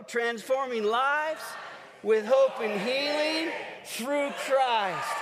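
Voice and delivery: slow, rousing stadium cheer; loud and proud. Real, not acted. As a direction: lead the crowd in a drawn-out chant. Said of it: "Do it loud and proud".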